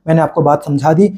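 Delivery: ordinary talk, nothing marked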